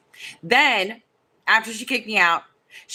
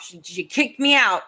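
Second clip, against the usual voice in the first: ordinary talk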